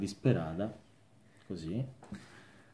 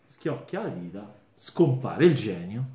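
ordinary delivery

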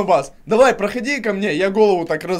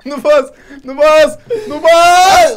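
taunting, louder each time; building shout; 4 semitones higher, 5 dB louder, much slower than usual. Real, not acted. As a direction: escalate from teasing to yelling.

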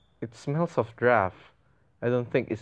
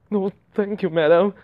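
higher, put-on polite refusal; raised-pitch refusal; 7 semitones higher, 3 dB louder than usual. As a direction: lift the pitch and push "madam" louder. Redoes polite refusal after "I mean.

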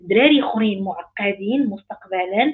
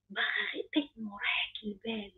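whisper; 13 dB quieter than usual. Real, not acted. Coach tells women what they truly want, whispered. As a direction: whisper باغا غير طيب راحة البال, all breath and no voice. Dreamy wish of a divorced woman.

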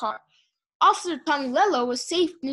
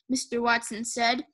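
ordinary delivery, nothing marked

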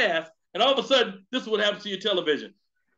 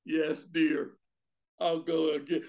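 low meek yes-dear husband, softer; a touch of henpecked husband, lower; 6 semitones lower, 3 dB quieter than usual. Retold or imitated. Husband giving in to his wife, low and meek.

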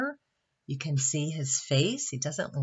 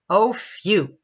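raised, louder sigh of relief; 6 semitones higher, 7 dB louder than usual. A narrator's reading. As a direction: let out a big relieved phew. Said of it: "sighed the little tree".

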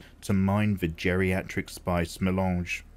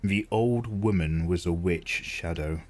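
usual speaking voice

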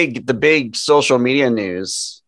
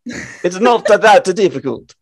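slow, higher Italian accent slipping into Transylvanian; failed Italian accent; 5 semitones higher than usual. Shown as a demonstration. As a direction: attempt Italian, land in Transylvania. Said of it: "That sounded way too, uh, Barovia or, uh, Transylvania".

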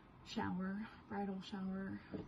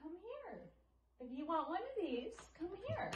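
coaxing; 8 semitones higher, 7 dB quieter than usual. Real, not acted.

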